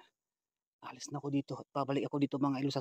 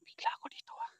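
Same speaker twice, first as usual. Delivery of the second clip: whisper; 8 dB quieter, faster than usual. Real, not acted. Sudden scare in the forest.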